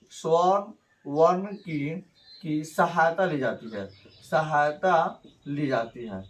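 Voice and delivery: slow, word by word for the class; slow dictation. Shown as a demonstration. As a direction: drag each word out for note-taking. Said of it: "teacher dictating a definition". (धीरे-धीरे बोलकर लिखवाना)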